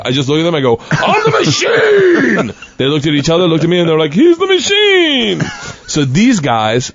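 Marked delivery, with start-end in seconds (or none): 0.9-2.5 high, drawn-out mock shout; stretched high holler; triumphant yell. Retold yell to room of gangsters. 4.1-5.4 high, drawn-out group-cheer imitation; high hollered proclamation; delighted cheer. Gangsters' retold delighted cry.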